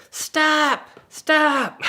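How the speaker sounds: loud, high shout; shouting stop. Retold or imitated. Man at the bottom of the hill yelling to the driver, loud shout. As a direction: yell it up the hill to stop him.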